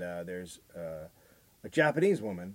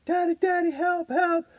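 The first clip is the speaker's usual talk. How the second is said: put-on much higher, pleading little girl voice; imitated crying girl; 4 dB louder than usual. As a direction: whimper for Daddy.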